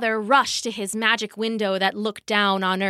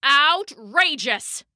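slow, pitched-up cry of outrage; indignant thunder god's bellow; 5 semitones higher, slower than usual. A narrator's reading. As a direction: bellow it, stretching the word. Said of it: "bellowed Thor".

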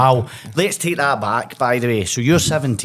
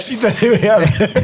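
laughing along; 8 semitones higher, much slower than usual. Real, not acted.

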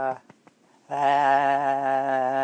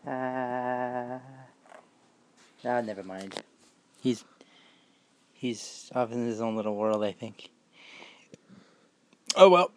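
normal talking voice

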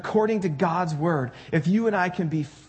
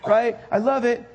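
higher, eager mock enthusiasm; gushing Genesis fan; 6 semitones higher than usual. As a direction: gush like it's day one of the plan. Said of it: "You're like".

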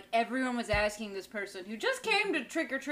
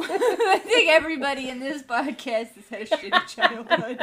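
giggling; 5 semitones higher, 8 dB louder than usual. Real, not acted. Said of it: "we apologize for the giggles".

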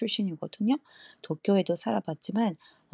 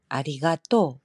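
Kansai 아리가또, louder, weight on the final 또; Kansai accent; 5 dB louder than usual. Shown as a demonstration. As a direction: lean on 또 at the end of 아리가또. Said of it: "칸사이 쪽".

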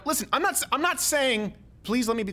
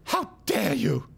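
mock Greta speech; 3 semitones higher, slower than usual.

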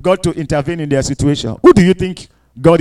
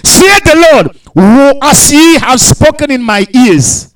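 preaching at full force; 3 semitones higher, 3 dB louder than usual. Acted. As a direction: thunder God's oath at them. Preaching God's oath at full force.

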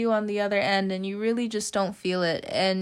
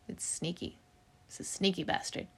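whispering; 4 semitones lower, 11 dB quieter than usual. Real, not acted. Confiding aside about loneliness.

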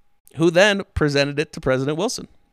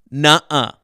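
a petulant, louder "Nuh-uh" in a put-on voice; mock Wilson pouting like a kid; childish denial; 8 dB louder than usual. Wilson to Germany, a mock childish refusal. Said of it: "The Woodrow Wilson is quoted going".

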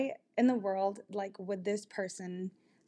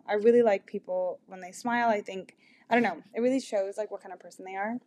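usual speaking voice

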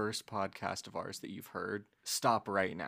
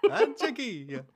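put-on Italian accent; 13 semitones higher, 6 dB louder, slower than usual. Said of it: "with the accent".

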